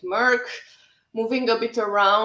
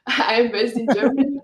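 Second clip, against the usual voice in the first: laughing; 4 semitones higher, 7 dB louder than usual. Real, not acted. Laughing aside about company confusion.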